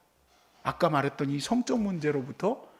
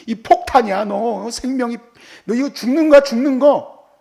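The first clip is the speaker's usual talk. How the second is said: put-on louder, grave warning to a teen; stern warning; 3 semitones higher, 8 dB louder than usual. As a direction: make it sound like deadly danger. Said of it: "라고 가르치지". (경고하듯 엄하게)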